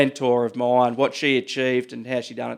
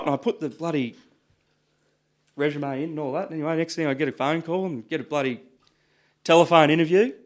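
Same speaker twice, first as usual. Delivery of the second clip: normal talking voice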